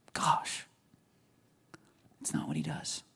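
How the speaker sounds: soft, breathy whisper; quietly whispered rebuke; whispered aside. Real, not acted. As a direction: drop to a near-silent hush. Hushed aside after mock quote.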